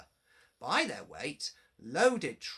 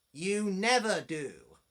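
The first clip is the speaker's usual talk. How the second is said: slow, firm scolding; a boss telling off the engine; stern; 3 semitones higher, 4 dB louder, slower than usual. A narrator's reading. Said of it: "said a stern voice".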